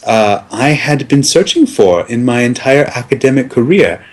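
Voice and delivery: ordinary talk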